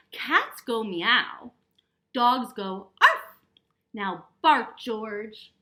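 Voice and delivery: loud, slow emphatic instruction; mother correcting. A narrator's reading. George's mother teaching him to bark, loud slow correction. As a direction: spell it out loudly and slowly for a puppy. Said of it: "said George's mother".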